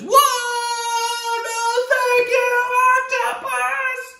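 very high, slow, sung-out wail; startled boy. Acted. Boy blown over by the tuba, high slow wail. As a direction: wail it while almost falling over. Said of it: "and he says".

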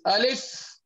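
Alif said with a gentle rising tune, light and open; model Arabic letter. Shown as a demonstration. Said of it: "Say it like this".

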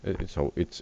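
ordinary delivery

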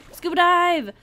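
eager gamer's whoop; excited cheer. Real, not acted. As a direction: cheer it out loudly. Excited to start diving.

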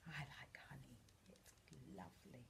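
very soft, low near-whisper; quiet low confiding aside; hushed aside. Real, not acted. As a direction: murmur it low, savouring. Soft aside to child about honey.

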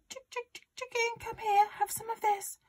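very high-pitched hen-calling voice; coaxing. A narrator's reading.

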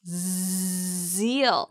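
Zeal with a buzzing voiced z; stretched Zeal with a strong z; clear Z sound. Shown as a demonstration. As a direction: buzz the z at the start of Zeal. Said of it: "Z 발음도 잘 살리시고요".

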